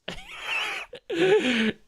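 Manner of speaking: sudden high shrieking laugh; real high-pitched burst of laughter. Real, not acted. Bursts out at comment.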